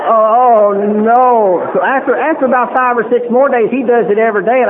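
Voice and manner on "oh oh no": mock horror. Comic horror, laughing audience.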